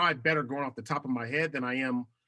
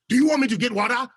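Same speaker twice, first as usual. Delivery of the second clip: much higher and louder, animated offer of water; naturally loud; 9 semitones higher, 10 dB louder, faster than usual. Retold or imitated. Nigerian family member offering water, loudly animated. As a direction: boom out a simple question with lively energy. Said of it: "we're naturally loud".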